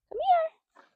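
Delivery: very high, sing-song coaxing call. Real, not acted. Trainer calls her nervous mare over in a high coax.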